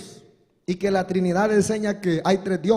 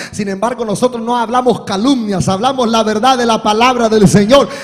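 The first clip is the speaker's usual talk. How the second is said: building from firm to a shouted hallelujah; preaching crescendo; 10 dB louder than usual. Acted. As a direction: swell up and cry out aleluya. (predicación que crece hasta gritar aleluya)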